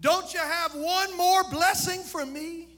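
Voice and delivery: pleading son. Retold or imitated. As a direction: beg like a son who lost his blessing. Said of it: "he pleaded, he said".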